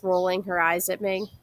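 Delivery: plain reading voice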